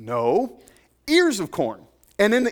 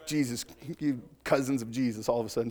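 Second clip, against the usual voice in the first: laughing aside; 6 semitones lower, 7 dB quieter than usual. Real, not acted. Teasing the laughing congregation.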